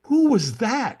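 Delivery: high, bewildered question; baffled. Retold or imitated. Baffled into the night after the stranger disappears. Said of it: "And I'm like".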